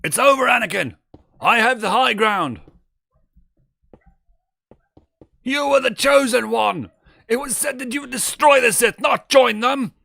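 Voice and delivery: Obi-Wan screaming at Anakin; Obi-Wan shouting. Acted. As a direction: yell it at Anakin in despair. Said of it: "my two favorite".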